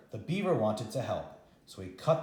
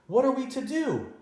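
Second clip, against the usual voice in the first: high, desperate question; anxious question; 12 semitones higher than usual. Acted. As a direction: plead with nobody in particular. Grandma's despair.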